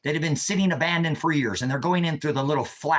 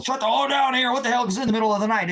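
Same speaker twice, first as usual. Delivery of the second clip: higher, quick mock yell at the noise; old neighbor yelling out, higher and hurried; retold angry neighbor; 6 semitones higher, faster than usual. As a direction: bark it fast like a woken neighbor. Armed neighbor yelling at night.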